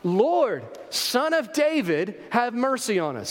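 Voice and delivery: high, drawn-out cry for mercy; calling out. Acted. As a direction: call out to someone passing on the road. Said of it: "who start calling out".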